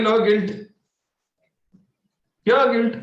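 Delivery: normal talking voice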